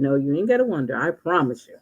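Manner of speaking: usual speaking voice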